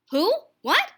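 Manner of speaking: high, loud, rising who-what; startled. Acted. Buddy startled by the varmint's order, surprised. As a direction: blurt it out surprised.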